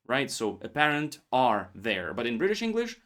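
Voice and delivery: ordinary delivery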